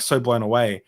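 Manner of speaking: ordinary delivery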